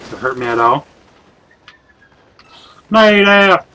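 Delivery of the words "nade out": grenade warning yell. Real, not acted. Warns team of grenade.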